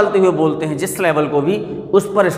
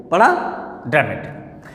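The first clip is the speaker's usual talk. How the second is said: ordinary talk, nothing marked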